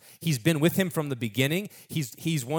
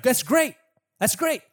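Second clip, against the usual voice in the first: high, enthusiastic proclamation; acted burst of royal excitement; delighted king; 6 semitones higher than usual. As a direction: announce a generous gift with delight. Acts King David's delighted decree.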